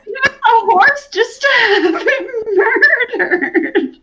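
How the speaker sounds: high, drawn-out cry through laughter; helpless high-pitched laughing protest; laughing wail. Real, not acted. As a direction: wail it slowly while cracking up. Laughing outcry over the horse's death.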